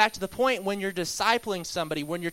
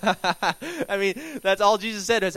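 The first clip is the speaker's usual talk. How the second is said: laughing as you talk, higher and louder; amused laughing; 3 semitones higher, 5 dB louder, slower than usual. Real, not acted. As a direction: chuckle through an amused aside. Amused by Nathanael being impressed.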